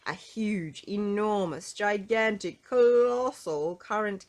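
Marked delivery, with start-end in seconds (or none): none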